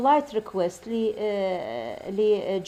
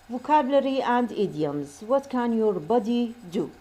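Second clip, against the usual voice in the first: ordinary delivery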